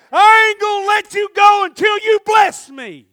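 loud, high, slow shouted vow; defiant shout. Acted. Jacob shouting at the angel he won't let go. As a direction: roar it like you'll never let go.

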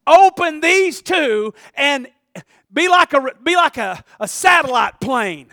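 loud, drawn-out preacher's delivery; full-force preaching. Real, not acted. Preacher urging congregation to shut down the lies, full-force preaching. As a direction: preach it out loud with stretched key words.

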